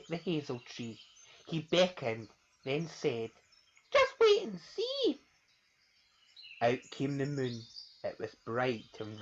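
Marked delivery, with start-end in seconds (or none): 3.9-5.2 very high, slow; sly mouse. Acted. Mouse taunting the child, high and slow. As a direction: tease with confidence.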